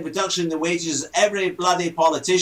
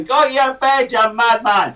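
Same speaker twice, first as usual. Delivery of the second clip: shouting neighbour; 4 semitones higher, 5 dB louder than usual. Retold or imitated. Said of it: "and the neighbours say".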